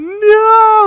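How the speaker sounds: very high, loud, stretched-out mock scream; retold anguished scream. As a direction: imitate a mother screaming in grief. Bereaved mom's scream, acted out.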